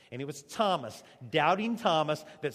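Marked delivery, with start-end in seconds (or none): none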